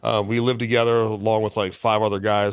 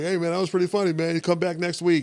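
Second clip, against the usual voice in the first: higher-pitched voice of an approving emcee; imitated encouraging host; 7 semitones higher than usual. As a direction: imitate a host inviting a newcomer back. Host's praise voiced in story.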